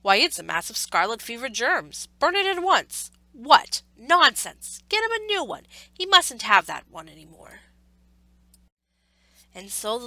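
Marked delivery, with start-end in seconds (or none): 0.0-7.6 loud commanding doctor; a doctor booming louder, alarmed and stern; stern doctor's orders. A narrator's reading. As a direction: order it burnt at once. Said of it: "said the doctor".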